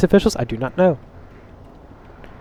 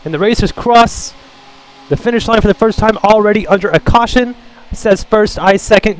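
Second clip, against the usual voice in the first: raised-pitch race commentary; animated play-by-play; 7 semitones higher, 5 dB louder than usual. Acted. Commentator calling positions under caution, animated. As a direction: call the running order like a commentator.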